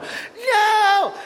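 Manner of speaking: very high shriek of disbelief; imitating a screaming waitress; freaking out. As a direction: squeal it like she can't believe it. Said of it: "She screams, starts freaking out".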